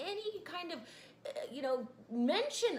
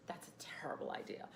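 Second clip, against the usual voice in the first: whispered, much softer; 7 semitones lower, 9 dB quieter than usual. Retold or imitated. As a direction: breathe it like a sneering critic. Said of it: "detractors that will say".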